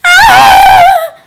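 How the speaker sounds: loud high shriek of frustration; hammed-up frustrated shriek; frustrated scream. Exasperated scream at the eye test.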